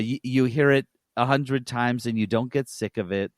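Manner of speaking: usual speaking voice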